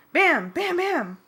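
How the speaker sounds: loud high bam bam bam; crash noises. A narrator's reading. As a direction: bang out each hit. Crash sounds in narration.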